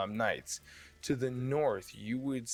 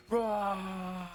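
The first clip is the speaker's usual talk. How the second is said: soldiers' roar; 9 semitones higher than usual. Acted. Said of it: "and they".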